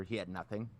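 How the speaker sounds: normal talking voice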